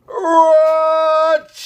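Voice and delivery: loud bursting bear sneeze; big sneeze. Acted. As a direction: let out a huge achoo.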